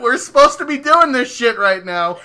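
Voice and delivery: loud, high mock indignation; retold exasperated demand; annoyed partner. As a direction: voice a fed-up partner, loudly. Voicing his annoyed partner.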